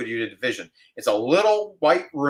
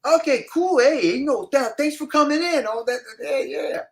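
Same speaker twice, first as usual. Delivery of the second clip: much higher, oily interviewer voice; casting guy interviewing the actor, high and smarmy; sleazy casting guy; 12 semitones higher than usual. Retold or imitated. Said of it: "the guy next to him goes".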